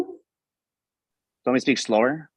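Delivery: drawn out, slow and careful; slow right down for the listener. Real, not acted. Speaker answers a listener's request to slow down, slowly. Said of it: "Can you speak slowly, please?".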